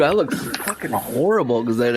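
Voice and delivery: usual speaking voice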